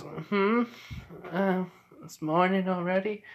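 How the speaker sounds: put-on high-pitched small child voice; waking little girl. Little girl Eri wakes up.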